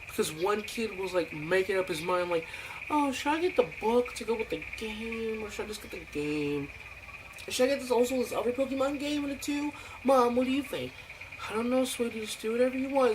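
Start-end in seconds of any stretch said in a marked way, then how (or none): 2.9-10.9 put-on high, fast whiny kid voice; mock a dithering kid asking mom; indecisive kid. 11.5-13.1 high mock mom voice; imitating a mom. Mom answering the kid.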